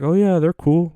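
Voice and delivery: a light imitation of a casual girl; imitating the girl. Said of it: "And she's like".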